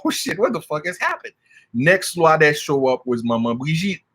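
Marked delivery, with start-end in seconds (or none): 0.0-1.3 shocked inner thought. Retold or imitated. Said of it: "I'm still like".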